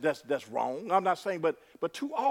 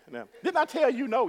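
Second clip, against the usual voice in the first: a hint of a scolding parent, higher; 8 semitones higher, 3 dB louder than usual. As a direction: warn the child you already said no.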